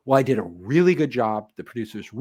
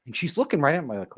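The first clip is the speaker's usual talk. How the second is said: usual speaking voice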